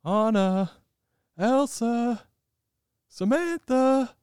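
high, slow calling voice, searching; high, slow calling out, Olaf style. Retold or imitated. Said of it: "he's like".

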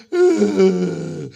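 loud, drawn-out mock groan; agonized groan. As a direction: groan loudly as if in agony. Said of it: "you just have groanings".

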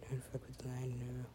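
breathy whispered warning; hushed whispered warning to the lion. Real, not acted. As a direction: whisper it to the screen. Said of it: "No, don't fuck with the lion".

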